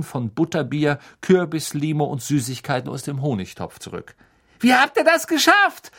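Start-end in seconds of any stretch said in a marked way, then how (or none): 4.6-5.9 high, loud shriek; excited screech. A narrator's reading. Angelina screeches to George and Fred at the party, excited screech. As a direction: screech it out far above your normal voice. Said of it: "kreischte Angelina Johnson".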